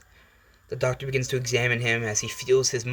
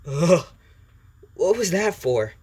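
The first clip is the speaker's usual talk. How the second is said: higher, louder, drawn-out complaint; Izuku; 6 semitones higher, 5 dB louder, slower than usual. Acted. Boy after the symbiote went down his throat, disgusted complaint. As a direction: groan into an indignant, slow question. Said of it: "And Izuku goes".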